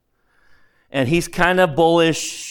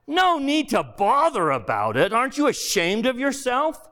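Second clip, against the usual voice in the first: indignant big ghost; 8 semitones higher than usual. Acted. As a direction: throw the accusation back at him. Ghost's scornful retort.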